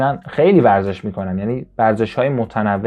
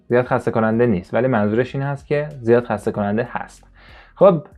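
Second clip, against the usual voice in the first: ordinary delivery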